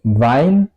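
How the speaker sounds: "Wein" starting on a v sound, pitch rising; W alemana como V. Shown as a demonstration. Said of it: "el mismo sonido". ("Wein" con sonido de V inicial)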